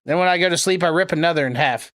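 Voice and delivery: usual speaking voice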